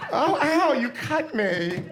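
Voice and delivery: yelping ow through laughter; laughing ow. Real, not acted. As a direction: laugh while saying you cut me. Audience member's gift cuts her.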